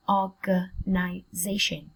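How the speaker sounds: slow, organization split into separate syllables. Shown as a demonstration. Teacher sounding out a word for students, slowly in syllables. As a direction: break organization up for learners.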